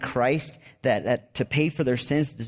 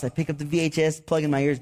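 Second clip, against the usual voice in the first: ordinary talk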